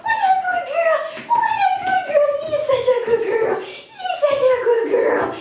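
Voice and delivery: very high, gushing praise for the dog; excited dog praise. Real, not acted. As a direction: gush over her like she's the best. Praising dog after recall.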